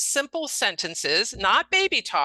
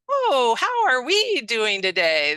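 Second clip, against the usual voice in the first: put-on slow, higher, sing-song condescending baby talk; 4 semitones higher, 4 dB louder, slower than usual. Shown as a demonstration. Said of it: "almost like baby talk".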